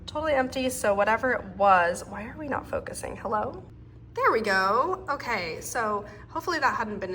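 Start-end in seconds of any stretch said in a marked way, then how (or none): none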